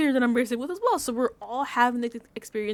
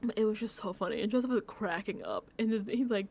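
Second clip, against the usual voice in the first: ordinary talk, nothing marked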